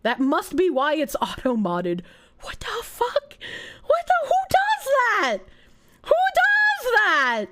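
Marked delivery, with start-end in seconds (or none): 2.4-3.4 whispered disbelief; shocked whisper. Real, not acted. Reading something shocking in chat, whispered shock. 3.9-7.5 high, loud, drawn-out disbelief; outraged disbelief. Real, not acted. Reacting to a chat fact with loud disbelief.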